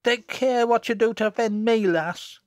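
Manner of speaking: put-on loud, high childish threat; threatening. A narrator's reading. Jennet menacing Susan, threateningly. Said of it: "threateningly at her".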